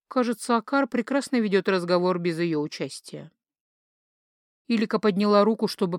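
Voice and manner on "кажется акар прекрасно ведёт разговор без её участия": ordinary delivery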